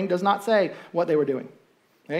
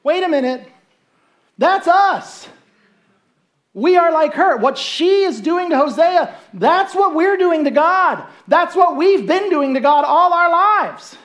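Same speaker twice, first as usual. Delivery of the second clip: higher, louder, slowing to a horrified admission; dawning realisation; 9 semitones higher, 6 dB louder, slower than usual. Acted. Israelites realising they are like Gomer, horrified. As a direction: gasp as the truth lands on you.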